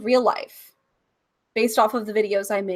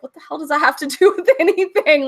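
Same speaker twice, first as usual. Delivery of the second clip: fast, louder, higher disbelief with a laugh; incredulous rant; 7 semitones higher, 6 dB louder, faster than usual. Retold or imitated. To people who mistook her character, fast incredulous outburst. Her retold indignant reply.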